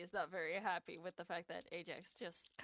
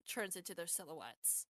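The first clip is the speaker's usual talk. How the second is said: ordinary talk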